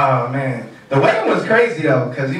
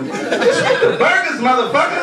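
yelling; 11 semitones higher than usual. Retold or imitated. Yelling at uncle.

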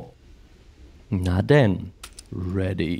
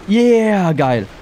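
excited cheer; 6 semitones higher, 7 dB louder than usual. Real, not acted. Cheer after surviving.

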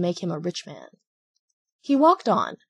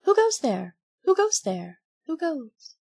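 distant echo; 8 semitones higher than usual. A narrator's reading. Hills echoing back Pinocchio's call, fading echo. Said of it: "the far-away hills echoed back to him".

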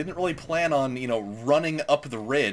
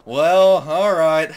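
high, loud whiny mockery; loud hammed-up disappointment; mock disappointed voice; 7 semitones higher, 11 dB louder, much slower than usual. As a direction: mimic the sore losers giving up. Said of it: "They're like".

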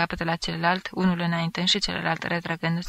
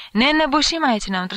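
higher, louder protest; powerful yell; 6 semitones higher, 5 dB louder than usual. A narrator's reading. A guest in the stifling cellar complains, yelling. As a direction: bellow a complaint over the crowd. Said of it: "Un glas puternic răcni".

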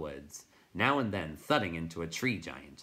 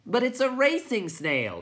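high, louder huffy protest; miffed; 8 dB louder than usual. A narrator's reading. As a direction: sound offended and defensive. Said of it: "somewhat miffed".

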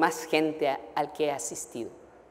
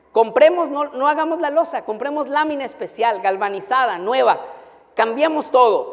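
higher, louder, the elder fired up to fix the roof; eager elder's pitch; 7 semitones higher, 4 dB louder than usual. Retold or imitated. Elder pressing the pastor to replace the roof, higher and louder with eagerness. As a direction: sell the idea with rising eagerness.

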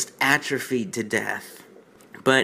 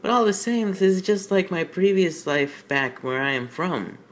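Twilight's voice; 6 semitones higher than usual. Acted. Reading Twilight's lines in voice.

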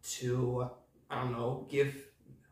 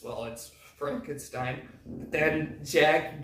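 ordinary talk

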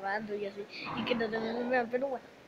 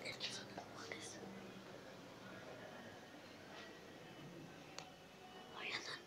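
whispering; 20 dB quieter than usual. Real, not acted. Hiding, whispering.

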